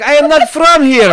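high, loud mock Russian accent. Retelling the concertgoer's giveaway line, hammed-up Russian accent. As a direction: exaggerate the imitated Russian accent. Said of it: "as soon as he said".

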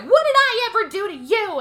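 high, loud cry of hurt; mock outburst. Rose shouting at Mary before hanging up, high and loud.

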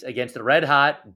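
ordinary delivery

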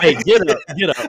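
loud, high-pitched mock order; mock kick-out. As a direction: play at booting someone out, loudly. Acted throwing out a failed recruit.

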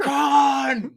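Khan screamed high and loud, laughing; the famous Star Trek Khan scream; Khan yell. Retold or imitated. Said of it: "Like the Star Trek?".

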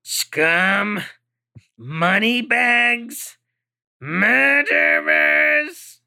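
slow, rising, drawn-out sneer; sneering bounty hunter on the radio; menacing alien hail. Acted. Alien hails the ship over radio.

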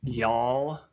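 Southern y'all. Shown as a demonstration. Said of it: "here in the South, we say y'all".

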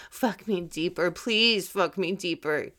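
urgent, pleading breathy cry; intense. Shown as a demonstration. Showing how an intense moment should sound, urgent. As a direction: push it out with desperate energy. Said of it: "that's a very intense moment".

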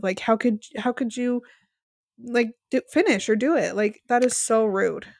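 normal talking voice

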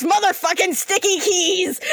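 high angry yell at the keyboard; frustrated shout. Acted. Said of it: "He goes".

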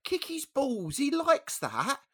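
put-on very high, drawn-out old-lady voice; slow, high granny encouragement; little old dear. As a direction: reassure in a squeaky old woman's voice. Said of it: "this little old deer".

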